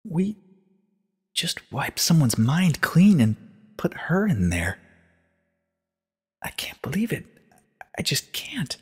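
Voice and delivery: high, slow, whispering in shock; barely able to say it; horrified disbelief. A narrator's reading. Twilight, horrified, whispers disbelief to Celestia. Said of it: "She looked away".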